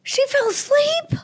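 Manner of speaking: shocked disbelief. Real, not acted. Shocked reaction to story.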